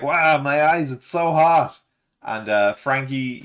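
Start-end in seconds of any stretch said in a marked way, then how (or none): 0.0-1.8 higher and louder, a hammed-up yelp; mock pained outburst at burning eyes; playful imitation of a hot-eyed cry; Luffy crying out. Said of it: "he's like".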